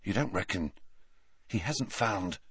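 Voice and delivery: hushed, tentative question; soft, halting speculation; whispered. A narrator's reading. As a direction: speak softly, leaving it hanging. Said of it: "whispered Ron".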